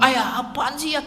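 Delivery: high, put-on grumpy kid voice; whiny child. Son grumbling at father waking him for subuh, high whiny. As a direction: play the sulky son woken for dawn prayer. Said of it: "kalau dibangunin subuh dia ngomong".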